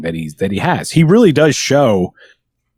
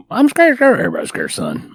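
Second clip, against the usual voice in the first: put-on Ernest's Southern accent. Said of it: "I don't know what accent it even is".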